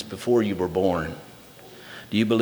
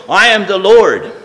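raised, ringing reading with the Lord's lines pushed louder; scripture reading; 6 semitones higher, 5 dB louder than usual. Acted. Preacher reads Isaiah forty-five to the church, ringing reading voice. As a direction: proclaim the verses as the voice of God.

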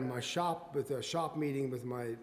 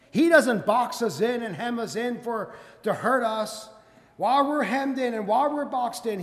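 loud, high-pitched, urgent preaching; full-force preaching; 7 semitones higher, 11 dB louder than usual. Real, not acted. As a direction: press it on the congregation with rising force.

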